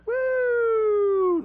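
high whooping woo; a kid's gleeful woo out the window; a high, whooping cheer; mock woo. Whoosh as the can flies out.

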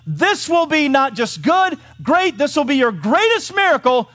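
high, loud, emphatic preaching; full-force preaching. Acted. God urging Peter to his greatest miracle, full-force preaching. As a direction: build it big, stretching the key words.

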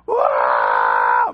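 sustained mock baby scream; screaming baby imitation. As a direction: mimic a baby howling from the crib. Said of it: "like, screaming".

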